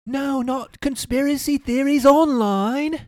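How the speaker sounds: very high, slow, loud mock-British wail; mock British horror. Co-host mocking the disinformation alarm, shrieky British accent.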